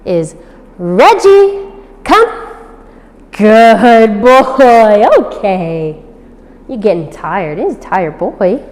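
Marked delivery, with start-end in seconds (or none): 0.8-2.3 high, loud call of the name; recall call. Shown as a demonstration. Trainer calling the puppy back, high and loud. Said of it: "So Reggie's recall is". 3.3-5.1 reward him with loud praise; excited praise. Real, not acted. Praising dog after recall.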